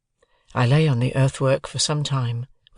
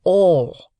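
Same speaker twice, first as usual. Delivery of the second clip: "All" punched louder and higher; very emphatic; 5 semitones higher, 6 dB louder than usual. A narrator's reading. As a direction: hammer home the single word "All". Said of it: "very emphatically".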